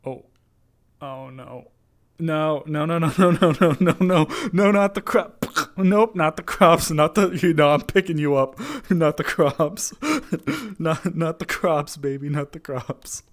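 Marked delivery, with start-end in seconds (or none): 2.2-4.3 loud laughing protest, high. Real, not acted. Pet grabbing the crops. 4.5-13.2 high, loud, slow not the crops; loud laughing scold. Real, not acted. Stopping pet eating the crops.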